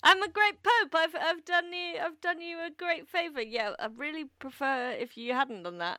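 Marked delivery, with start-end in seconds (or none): 0.0-3.4 high, loud, self-satisfied pope voice; smug pope. Retold or imitated. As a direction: play a pompous pope patting himself on the back. Mocking the pope's decree.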